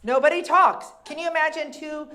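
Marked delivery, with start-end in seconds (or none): none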